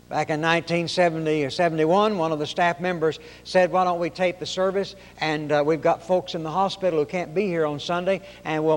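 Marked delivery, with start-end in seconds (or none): none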